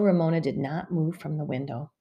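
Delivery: ordinary delivery, nothing marked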